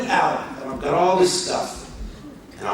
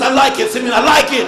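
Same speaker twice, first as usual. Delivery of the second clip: overwhelmed reassurance; 5 semitones higher, 8 dB louder than usual. Retold or imitated. Pastor overwhelmed, reassuring host.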